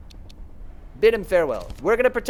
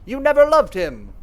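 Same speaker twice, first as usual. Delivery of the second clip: higher, louder accusation; grieving Clarissa lashing out at Veronica, loud and high; Clarissa's outburst; 6 semitones higher, 6 dB louder than usual. Acted. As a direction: hurl the accusation.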